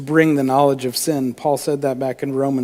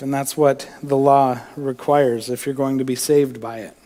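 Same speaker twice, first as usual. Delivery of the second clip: usual speaking voice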